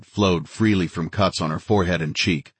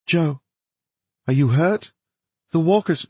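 worried woman; 10 semitones higher than usual. A narrator's reading. Worried woman voiced by narrator.

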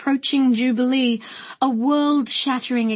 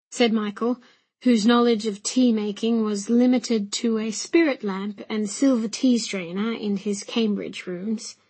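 ordinary delivery

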